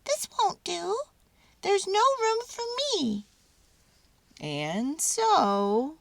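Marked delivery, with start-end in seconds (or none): 0.0-3.3 high, squeaky little mouse voice. A narrator's reading. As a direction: squeak it like a tiny mouse catching on. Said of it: "squeaked Mortimer".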